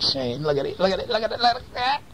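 rising singsong high teasing; playful teasing. Real, not acted. Teasing child about bee ears.

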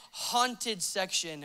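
slow, hushed, ominous; spooky sign reading. Acted. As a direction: read it slowly and softly, building suspense.

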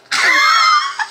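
piercing squeal of laughter; high-pitched shriek laugh. Acted. Showing what she saw across the gym, squealing laugh. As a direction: let out a very high, drawn-out squeal. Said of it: "All I see across the gym is this".

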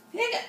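squeaky jumped-up hiccup on the word; cute little piglet hiccup; high hiccup. Acted. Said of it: "juggling those eggs".

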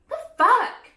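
high, loud incredulous the fuck; outraged disbelief. Real, not acted. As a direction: shoot it up in shock. Outraged at viewers.

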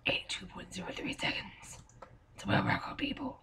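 hushed whispered announcement; breathy secret whisper to the viewers. Real, not acted.

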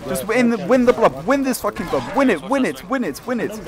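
loud, high, hyped battle cries; excited shouting. Real, not acted. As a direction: yell them on to victory. Commander hyped during winning fight.

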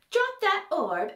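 high, slow dramatic order; taunting command. A narrator's reading. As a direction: draw out a villain's taunting command. Villain taunting a captive dramatically.

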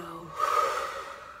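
long, airy exhale; demonstrated out-breath sigh; releasing sigh. Shown as a demonstration. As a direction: let all the breath go in a sigh. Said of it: "Really let go".

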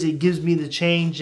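ordinary talk

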